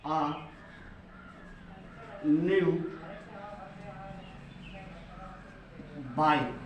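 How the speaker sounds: isolated words with very long pauses; slow, halting write-along delivery; word-by-word dictation. Real, not acted. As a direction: say each word singly while writing it. Writing an example sentence word by word.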